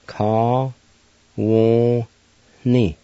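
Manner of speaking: "Ka, wo, ni" in separate long syllables, slightly nasal N; syllable-by-syllable pronunciation. Shown as a demonstration. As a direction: sound out "Ka, wo, ni", breathy H before a nasal "ni". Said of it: "pronounced like this".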